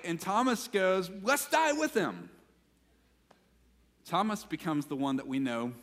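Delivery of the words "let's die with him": high, drawn-out, slow cry; mock-heroic, pitched way up; misplaced rallying cry. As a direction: call it out like an over-eager amen. Thomas's misplaced rallying cry, retold.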